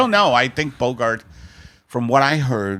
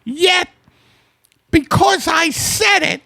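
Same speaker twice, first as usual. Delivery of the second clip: much higher falsetto wife voice; a shrill-free falsetto Margaret, bossy; high put-on wife voice; 5 dB louder, slower than usual. Husband teased by his wife, in a high falsetto wife voice.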